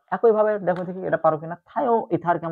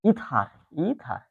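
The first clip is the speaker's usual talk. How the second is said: slow; 4 dB quieter, much slower than usual. Shown as a demonstration. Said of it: "বলবো আমরা".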